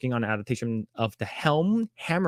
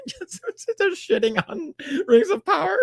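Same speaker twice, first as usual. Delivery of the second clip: laughing. Real, not acted. Laughing at the statement.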